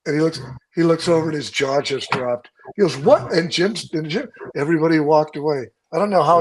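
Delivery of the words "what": high, loud stunned what; jaw-drop disbelief; shocked what. Retold or imitated. Jeff seeing truck on rails.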